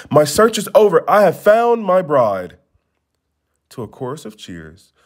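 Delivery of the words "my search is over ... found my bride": higher, ringing announcement; proclaiming. Acted. Prince announces his bride to the palace crowd, ringing proclamation. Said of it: "He proclaimed to the cloud".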